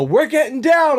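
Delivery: high-pitched excited yell; retold yell. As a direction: call it out high across the water. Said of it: "And I just yell".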